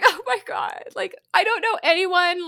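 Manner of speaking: high, loud, upset cry of panic; distressed drunk wail. Retold or imitated. Retelling crying at a club, a mock wail. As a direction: relive the drunken breakdown, voice up high.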